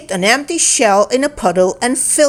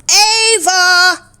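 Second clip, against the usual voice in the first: high, louder call of a name; 9 semitones higher, 4 dB louder than usual. A narrator's reading. Cody looks for his friend Ava, calling out. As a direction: call across the forest. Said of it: "cried Cody".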